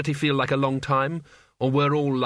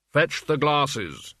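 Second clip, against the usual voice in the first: slow, imperious order; weighty Death voice; Death's commanding voice; much slower than usual. A narrator's reading. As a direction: command the servant, stretching each word. Death orders his servant.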